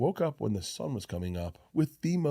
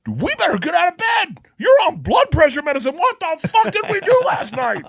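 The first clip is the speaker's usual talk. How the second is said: much higher, louder panicked yelling; morning-after inner voice yelling in alarm; anxiety voice shouting; 9 dB louder than usual. Retold or imitated. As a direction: scream at yourself the morning after. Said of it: "hear that guy just".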